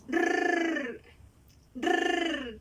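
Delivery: "rrr" trilled on the tongue tip; rolled r. Shown as a demonstration. Teacher to pupils, trilling the R sound. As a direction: roll the "rrr" hard. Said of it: "la lengua al paladar y sacamos el aire".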